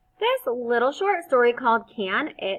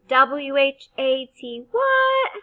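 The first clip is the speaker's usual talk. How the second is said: letters spelled slowly, then the word popped up high; spelling out letters; 5 semitones higher, 8 dB louder, slower than usual. Shown as a demonstration. Teacher spelling a sight word for first graders. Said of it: "This is what we do".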